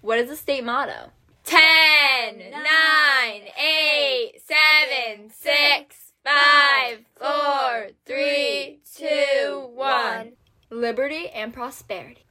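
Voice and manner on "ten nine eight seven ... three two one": loud, high crowd countdown; new year countdown crowd. Acted.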